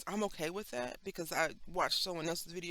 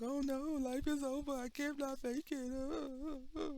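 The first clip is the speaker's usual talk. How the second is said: high, melodramatic despair; mock panic; 9 semitones higher than usual. Freaking out over a project, mock despair. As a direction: ham up the end of the world. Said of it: "You have to be like".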